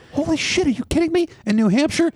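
very high-pitched disbelief; retold astonishment, pitch way up; incredulous outburst. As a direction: squeak it out, utterly incredulous. Retold disbelief at lax police.

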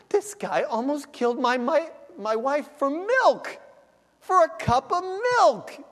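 building outrage at the absurdity; outraged disbelief. Pretend. Husband driving wife to hospital, rising outraged disbelief. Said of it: "And you're thinking".

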